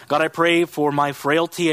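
usual speaking voice

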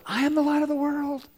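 a hint of a mother pushing the cue harder. Mom prompting her frozen son again, light high mock whisper. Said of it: "she whispers a little bit louder".

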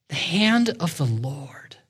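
hushed whisper for weight. Real, not acted. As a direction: breathe it out to draw them close. Preacher stresses a key phrase.

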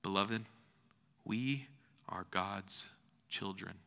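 ordinary talk, nothing marked

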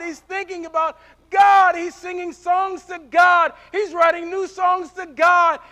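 rising to a loud, shouted preaching climax; preaching at full force. Acted. Pastor shouting to his church about David, full-force preaching. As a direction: preach it out to the congregation, building and shouting.

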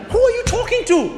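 very high, loud shocked rebuke; a parent exploding at the child; outraged parent. Acted. Parent shouting at the rude boy, very high.

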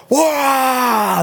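high, drawn-out roar; wild crowd cheer. Acted. Crowd erupting at a baptism confession, wild cheer. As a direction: imitate a whole crowd erupting. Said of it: "the crowd goes wild again".

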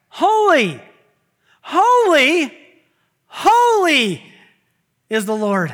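ringing, high, loud cry of holy; angels' shout. Retold or imitated. Preacher voicing seraphim, shouting holy to the congregation. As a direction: call it out like seraphim round the throne. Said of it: "they shout back and forth".

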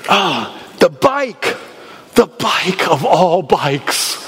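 slow, savoring, dreamy; dreamy awe. Retold or imitated. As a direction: draw it out in wonder. Savoring the bike, slowed.

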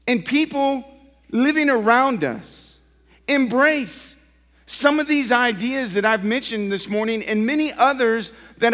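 loud, rising sermon delivery, pressing each phrase; preaching at full force. Acted. Pastor challenging his congregation, preaching loud and high.

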